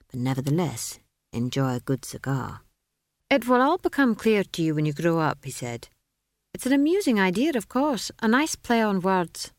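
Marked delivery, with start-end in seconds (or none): 3.3-5.4 lightly voiced condescending wizard, higher than narration; a hint of a pompous elder; patronizing wizard. A narrator's reading. Wizard talks down to child. 6.5-9.5 high, condescending wizard voice; be an amused wizard humouring a child; lofty, high-pitched dismissal; patronizing wizard. A narrator's reading.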